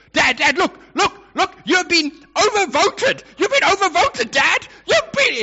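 put-on high, loud whiny four-year-old pleading; upset little boy. Four-year-old son protesting to dad in the car, high and upset. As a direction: squeak it out like a frantic small child. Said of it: "he's getting emotional".

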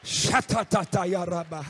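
a slow burst of tongues; speaking in tongues. Acted. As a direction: drop into tongues. Tongues again.

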